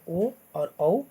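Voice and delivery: "ओ" and "औ" from the throat with rounded lips; throat-lip vowels. Shown as a demonstration. Teacher showing vowels made with both throat and lips, throat-lip vowels. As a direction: say "ओ", then "औ", as separate model vowels. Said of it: "दोनों से उच्चारण जिनका होता है".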